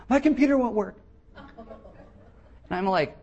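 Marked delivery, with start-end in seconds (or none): 0.1-1.0 high, louder mock-distressed woman; hammed-up wail over a trivial problem; panicked lady. Pastor imitating the lady who called, panicked lady voice. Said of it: "She goes".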